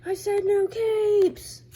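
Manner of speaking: high, loud mock rage, slow and stretched; angry shouting. Bullman yelling at someone in a comic, hammed-up furious shout. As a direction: bellow it like a cartoon boss losing it. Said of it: "their heads get super big and they start shouting".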